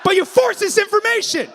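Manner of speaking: high, loud wounded protest; aggrieved mock shout. Man with eye patch berating comic, aggrieved high shout. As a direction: yell it like someone forced to confess. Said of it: "He's like".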